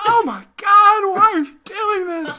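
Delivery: high, loud, slow wailing mock voice; "Oh my God" voice. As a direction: wail it high and loud through laughter. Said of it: "what to call that voice".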